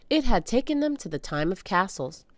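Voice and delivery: usual speaking voice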